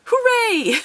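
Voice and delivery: high, hammed-up hooray; mock cheer. Real, not acted. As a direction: cheer way up for the card. Gets Meganium card, cheers.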